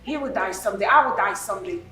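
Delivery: mother dismissing her brother-in-law, cold and low; cold dismissive. Acted. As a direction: shrug off his grief coldly.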